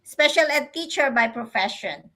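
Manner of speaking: ordinary talk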